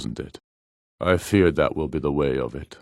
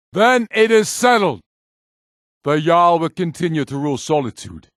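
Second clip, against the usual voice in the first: high, loud ruling declared aloud; formal proclamation; 9 semitones higher, 4 dB louder than usual. Acted. Ulfric announces the peace terms, formal proclamation. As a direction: announce the settlement to all.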